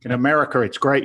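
ordinary delivery

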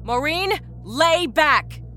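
high, urgent order; shouting. A narrator's reading. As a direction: shout a patient down firmly. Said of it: "Rita shouted".

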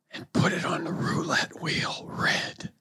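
eerie whispered instruction; ghostly whisper. Retold or imitated. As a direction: whisper like a phantom. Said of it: "the voice says".

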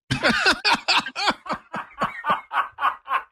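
real laugh, high and breathy; hearty laugh. Real, not acted. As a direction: laugh out at the punchline. Laughs after the punchline.

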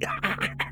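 breathy monster cackle; evil cackle. Acted. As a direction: cackle like a gloating beast. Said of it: "The cackle returned".

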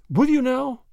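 high, bright-eyed eager question. A narrator's reading. Grandma jumping at the dark man's offer of help, eagerly. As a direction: jump at the offer, pitch lifted right up. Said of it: "said Grandma eagerly".

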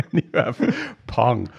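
laughing. Real, not acted. Joking about the word Pong.

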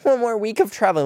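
high, quick exaggerated complaint; mock struggle. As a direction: groan it out as if it's a huge ordeal. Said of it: "acting like this is such a struggle".

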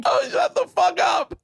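very high astonished outburst; shocked disbelief. Real, not acted. Listener reacting to a wild coincidence, shrieked amazement.

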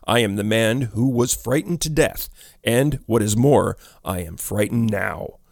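usual speaking voice

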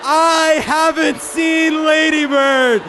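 slow, loud gloating taunt; sneering wrestling villain. Acted. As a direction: bait the crowd like a heel. Repeats line as villain taunt.

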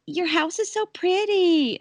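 high, uneasy polite chirp; high tense small talk; nervous guest. Retold or imitated. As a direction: force a compliment while scared. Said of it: "she's like".

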